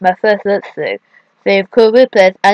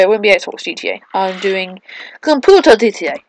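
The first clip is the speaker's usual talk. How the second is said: normal talking voice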